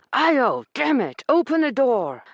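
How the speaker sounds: high, loud shout through the door; angry shout. A narrator's reading. Corbin outside the door, shouting angrily. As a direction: pound the words out in anger. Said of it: "angry male voice shouted".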